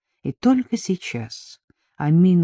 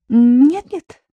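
high, louder, flustered protest; hurried, raised-pitch reply; hasty denial; 8 semitones higher, 6 dB louder than usual. A narrator's reading. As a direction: jump in quickly to deny it. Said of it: "поспешно ответила Амина".

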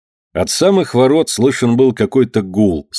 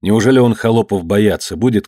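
plain reading voice

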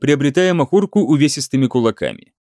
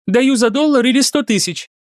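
put-on vulgar girl voice; 9 semitones higher than usual. A narrator's reading. Girl echoes in unison.

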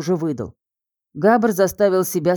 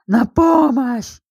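desperate scream for help; 6 semitones higher, 4 dB louder, much slower than usual. Acted.

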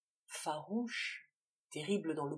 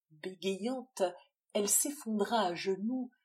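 plain reading voice

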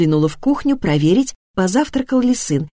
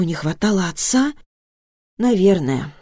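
ordinary delivery

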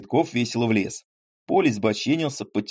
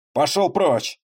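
loud, higher snapping at him; angry retort; 6 semitones higher, 4 dB louder, slower than usual. Acted. Поль angrily pushes the landowner away from the reins. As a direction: tell him to clear off, angry. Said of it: "возразил Поль сердито".

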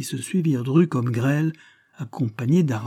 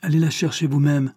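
curt, higher-pitched rebuff; gruff dismissal with raised pitch; surly; 5 semitones higher, 4 dB louder than usual. A narrator's reading. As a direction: snap it back like a grudging servant. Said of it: "répondit d'un ton rogue".

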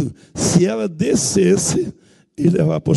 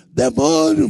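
shouted command; 9 semitones higher, slower than usual. Retold or imitated. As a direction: yell it out at the demon. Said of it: "gritei alto".

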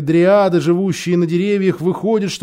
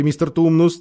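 usual speaking voice

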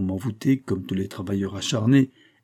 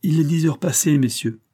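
impertinent; 6 semitones higher, 4 dB louder than usual. A narrator's reading. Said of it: "du même ton impertinent".

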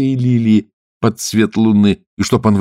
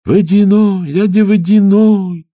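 high, loud drawn-out call; 12 semitones higher, 6 dB louder, slower than usual. Acted. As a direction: call across the water. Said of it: "стали звать русалки". (зовут громко)

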